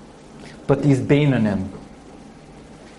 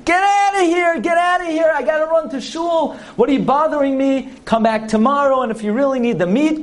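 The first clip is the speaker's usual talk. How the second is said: butcher screaming; 10 semitones higher than usual. Acted. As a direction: shoo them out, you're late for shul. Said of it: "and the butcher's screaming".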